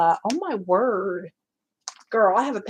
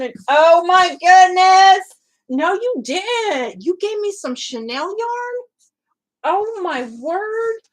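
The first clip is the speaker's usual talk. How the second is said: high, drawn-out amazed exclamations; gasp-worthy surprise, stretched out slowly; stunned delight; 9 semitones higher, 9 dB louder, slower than usual. Real, not acted. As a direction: start loud, stay high and amazed. Amazed at unexpected gift yarn.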